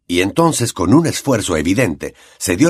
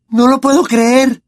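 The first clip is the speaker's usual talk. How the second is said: put-on much higher and louder, shrill disbelief in a girl's voice; Hermione shouting; 5 dB louder than usual. A narrator's reading. Hermione cries out at Lupin, much higher, louder, disbelieving. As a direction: cry out in shocked disbelief. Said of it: "gritó Hermione".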